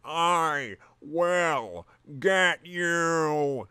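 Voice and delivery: slow, drawn-out hammed-up threat; a touch of cartoon fury, stretched way out; mock rage. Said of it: "filled with an oily rage".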